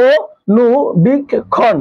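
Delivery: অনুবীক্ষণ stretched out very slowly, each syllable drawn apart. Shown as a demonstration. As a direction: draw out অনুবীক্ষণ slowly for the pupils. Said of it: "একটু ভাঙার চেষ্টা করি". (অনুবীক্ষণ খুব ধীরে, প্রতিটি অক্ষর টেনে টেনে)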